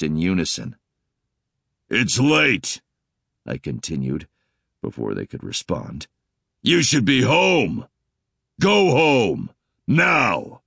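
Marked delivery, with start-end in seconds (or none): 1.9-2.8 deep, commanding alien voice; booming bass, stern; extremely deep bass voice. Acted. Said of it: "in the deepest voice I could manage". 6.6-10.6 loud, slow bass order to leave; booming dominant male command; extremely deep bass voice. Acted. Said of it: "in the deepest voice I could manage".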